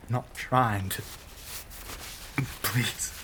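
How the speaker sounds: halting, breathy begging with gasps between phrases; strained, broken-up begging; desperate pleading. Acted. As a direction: plead as if barely holding back a craving. Begs while resisting the blood.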